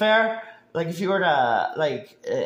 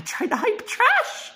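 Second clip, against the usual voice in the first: mock hype voice; slower than usual. Mocking the commentator's hype.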